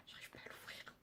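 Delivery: whispered aside to herself; murmured under her breath; whispering. Real, not acted. Aside while struggling with lid.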